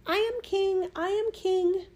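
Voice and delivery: very high, tiny bird's cry; piping voice. A narrator's reading. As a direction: pipe it out like a little bird in a hole. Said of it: "cried out in his piping voice".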